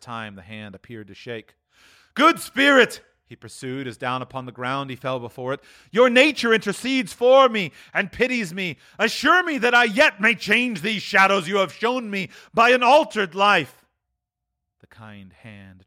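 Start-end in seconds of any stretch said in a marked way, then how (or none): 2.1-3.0 high, loud entreaty; imploring cry. Acted. Scrooge on the ground before the spirit, imploring cry. 5.9-13.8 high, imploring voice; fervent pleading. Acted. Scrooge begging to change his fate, high fervent plea.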